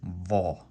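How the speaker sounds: throaty va from the Adam's apple; heavy va. Shown as a demonstration. Teacher showing learners the heavy throaty va. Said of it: "around the area where your Adam's apple".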